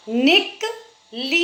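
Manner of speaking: "निकली" slowly, short "निक", pause, long "ली"; syllable by syllable. Shown as a demonstration. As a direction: break up "निकली" and stretch the last ee. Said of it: "अगर हमारा उच्चारण सही होगा".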